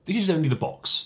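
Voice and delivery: sharp impatient retort; impatient pilot. Acted. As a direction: throw it out, patience gone. Pilot impatient, tosses off drawing.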